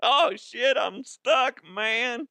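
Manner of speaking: high-pitched mock panic; hammed-up high voice of someone stuck; stuck burglar.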